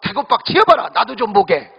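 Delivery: gruff groom answering wife, Gyeongsang dialect. Retold or imitated. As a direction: snap back like a gruff Gyeongsang husband. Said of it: "경상도 신랑이 하는 이야기가".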